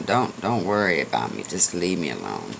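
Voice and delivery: put-on an old horse too tired to argue; weary horse fading away. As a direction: let the horse sink into giving up. Tired horse wants to be alone.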